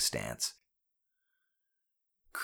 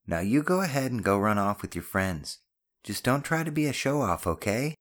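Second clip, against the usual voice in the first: higher-pitched mother sending son off; light parental warning, pitched up; loving mother; 5 semitones higher than usual. Acted. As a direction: shoo him off with a gentle caution. Voices the mother.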